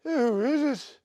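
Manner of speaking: lightly hammed-up Ozzy question; imitating Oz. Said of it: "And he just goes".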